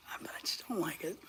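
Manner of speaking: very soft, near-whispered admission; hushed aside. Real, not acted. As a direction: drop almost to a whisper. Confessing dislike after listing gear.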